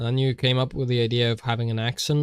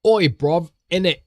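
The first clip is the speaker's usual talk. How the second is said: loud, higher hammed-up English accent; mock English accent; 6 semitones higher, 9 dB louder than usual. Streamer badly emulating an English accent, mock English shout. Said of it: "Do not ask me to emulate an English accent".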